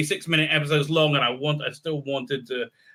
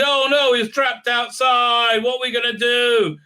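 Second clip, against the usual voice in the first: mock panic; 8 semitones higher, 5 dB louder than usual. Crew panicking about the trapped man, mock panic. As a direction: overplay the fright.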